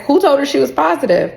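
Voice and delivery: high imitation of a baffled supervisor; incredulous. Supervisor questioning the nurse, retold in high disbelief.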